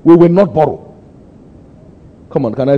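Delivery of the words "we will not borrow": high, loud proclamation; fervent shout. Retold or imitated. Preacher quoting his vow to the congregation, loud and high. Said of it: "I said".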